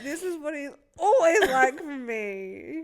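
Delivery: slow mock despair; exasperated quote. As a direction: plead it in exasperation. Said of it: "and I'm like".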